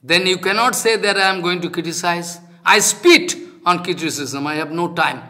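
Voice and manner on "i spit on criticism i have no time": ordinary talk, nothing marked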